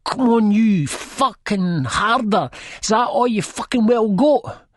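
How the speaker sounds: drawn-out, high-pitched screamed taunt; mocking scream. A narrator's reading. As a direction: scream it as a jeering challenge. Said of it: "she's screaming, sort of mocking".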